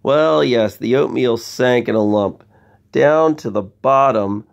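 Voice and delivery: ordinary delivery, nothing marked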